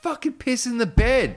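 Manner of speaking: high, loud furious accusation; angry yell. Retold or imitated. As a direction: yell at him in disbelief. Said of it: "I was so angry".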